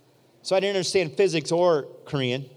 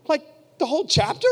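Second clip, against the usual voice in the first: incredulous; 9 semitones higher, 4 dB louder than usual. Retold or imitated. Retold disbelief at the demand.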